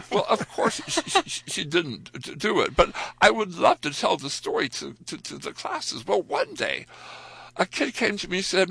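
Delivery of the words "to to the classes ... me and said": usual speaking voice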